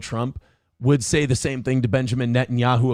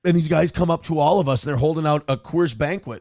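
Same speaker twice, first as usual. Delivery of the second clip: normal talking voice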